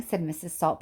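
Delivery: ordinary delivery, nothing marked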